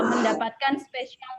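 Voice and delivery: normal talking voice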